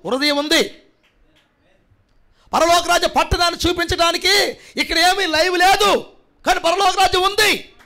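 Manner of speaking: rising, ringing pulpit shout; preaching at full force. Acted. Pastor warning the congregation about conscience, preaching at full force. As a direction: hammer each line home to the congregation.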